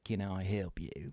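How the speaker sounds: slow, badly done Australian bouncer voice; a clumsy Australian accent for the doorman; mock Australian accent. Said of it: "And that was a horrible accent".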